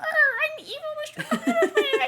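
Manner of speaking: high squeaky laughing villain voice; mad scientist. Retold or imitated. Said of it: "and he's like".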